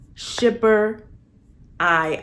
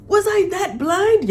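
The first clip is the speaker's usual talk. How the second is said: Edwina's shock; 13 semitones higher, 6 dB louder than usual. Retold or imitated. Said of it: "she's like".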